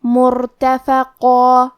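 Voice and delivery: مرتفقہ very slow, heavy را, stop on a long alif; heavy recitation. Shown as a demonstration. Teacher demonstrating مرتفقہ, slow heavy recitation. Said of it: "مد الف کو موٹی آواز میں پڑھنا".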